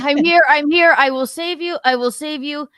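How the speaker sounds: high loud heroic firefighter shout; firefighter shouting through mask. Pretend. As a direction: call out to the victim through smoke.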